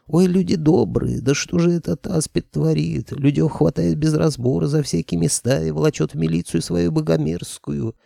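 high, wailing market trader; market woman wailing. Acted. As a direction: wail it out like an outraged old woman. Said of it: "по крику". (причитающая торговка)

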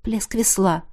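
usual speaking voice

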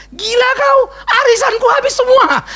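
high, loud furious yelling; raging wife. Acted. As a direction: scream it at him like an enraged wife. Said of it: "Ngamuk lagi istrinya". (istri mengamuk berteriak)

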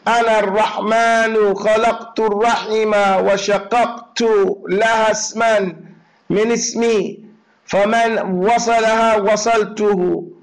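fast, loud Arabic recitation. Acted. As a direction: recite the hadith briskly and firmly.

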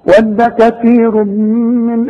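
slow melodic tajweed chant; Quran recitation. Acted. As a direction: recite slowly with held vowels.

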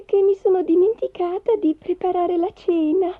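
lightly excited wish list, rising high; high character voice, eager; eager wife. Acted. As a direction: reel off dreams, then a high flustered confession. Wife reads wishes, then remembers.

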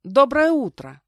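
loud, high, perky morning greeting; cheerful. A narrator's reading. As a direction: announce it brightly and briskly. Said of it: "объявила я бодро". (бодро, громко)

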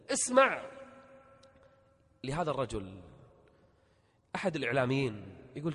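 ordinary talk, nothing marked

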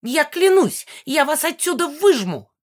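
high, loud vengeful vow; spiteful ghost crying out; malicious cry. A narrator's reading. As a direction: swear revenge in a high screech. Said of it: "закричала Мегара, злобно хохоча".

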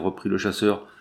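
plain reading voice

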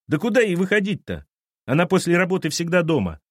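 put-on high, indignant boy's voice; defensive boy. Boy to captain, high and defensive. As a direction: defend mum, offended.

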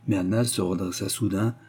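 ordinary delivery

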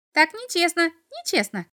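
high, protesting imp voice; chattering little devil. A narrator's reading. Little devil objects to the boy's question, chattering. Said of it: "затараторил чертёнок".

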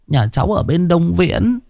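high, tearful begging voice; a frightened wife pleading for her dying husband; pleading peasant woman. A narrator's reading. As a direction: beg the old man for shelter, pitched high. Woman answers the old man.

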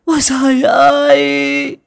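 high, very loud wail with long-held vowels; keen loudly to heaven, dragging out each cry; shrieking lament. Acted. Stepmother kneels by the body blaming heaven, shrieking lament. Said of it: "Mụ Vũ quỳ xuống lại chu chéo".

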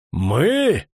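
a loud bark of a single word. A narrator's reading. Officer barks at the deserting woman. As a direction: snap it out at the rebel. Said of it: "рявкнул Дакрас Элефант".

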